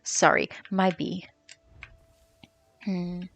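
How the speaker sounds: a soft whispered apology to herself; muttered whisper. Real, not acted. Streamer apologizing to her game bee in a whisper. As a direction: whisper it under your breath.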